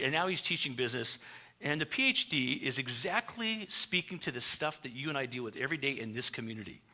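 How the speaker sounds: normal talking voice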